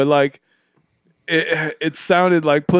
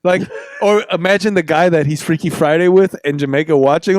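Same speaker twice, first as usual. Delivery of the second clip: ordinary talk, nothing marked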